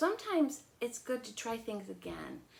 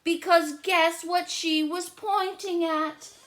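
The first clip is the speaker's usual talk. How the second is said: louder, slow, higher storytelling; building suspense; 9 semitones higher, 10 dB louder, slower than usual. A narrator's reading. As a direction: draw the kids in.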